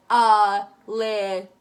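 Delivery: Alyssa in three slow, loud beats; exaggerated syllables. Shown as a demonstration. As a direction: open wide on each part of Alyssa. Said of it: "do it with anybody's name".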